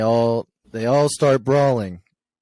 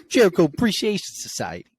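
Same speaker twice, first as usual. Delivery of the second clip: hammed-up New York accent, higher; mock New York accent with a laugh; 9 semitones higher, faster than usual. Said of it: "my terrible New York accent".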